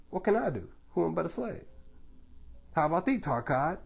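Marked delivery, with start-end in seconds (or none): 0.1-1.6 high-pitched slave's whine; whining. A narrator's reading. Soul of a slave complaining helplessly, whining. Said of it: "the soul of a slave whines".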